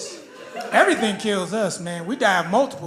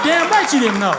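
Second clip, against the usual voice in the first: loud smug retort; 5 semitones higher, 6 dB louder than usual. Real, not acted.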